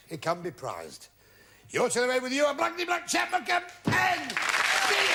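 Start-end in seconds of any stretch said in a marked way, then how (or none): 1.7-5.2 announcer excitement. Real, not acted. Host to losing contestant, high excited prize shout. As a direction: sell the prize like a jackpot.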